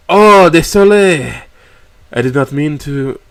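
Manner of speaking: slow, startled French dad; French apology. A narrator's reading.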